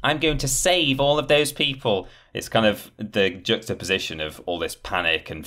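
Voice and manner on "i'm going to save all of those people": silly heroic player. Retold or imitated. Host mocking the gamer's fantasy of being the hero, boastful. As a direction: puff up like the player who thinks he's the saviour. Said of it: "that silly sort of".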